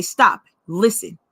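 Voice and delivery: lightly voiced spirit, slow and low; warning spirit voice. Spirit halting the speaker at the door, low warning.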